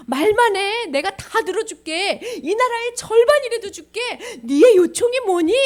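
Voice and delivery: high, loud, delighted generous king; king in good humour. Acted. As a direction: offer it grandly, stretching the words. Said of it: "임금님이 기분이 좋게". (기분 좋은 임금님의 큰 목소리)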